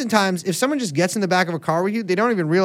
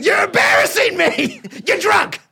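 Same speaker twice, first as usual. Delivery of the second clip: furious shout; 7 semitones higher than usual. Acted. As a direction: scream at him like an embarrassed wife. Acted quarrel, wife yelling drunk.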